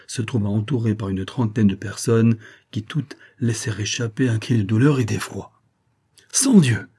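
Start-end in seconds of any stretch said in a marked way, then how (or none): 6.3-6.9 exclaimed oath. A narrator's reading. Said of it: "exclama le général".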